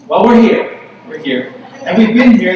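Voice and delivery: loud, proud retort; we're here, said loud and proud; emphatic declaration. Real, not acted. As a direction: answer them back with force. Proud answer to the refrain.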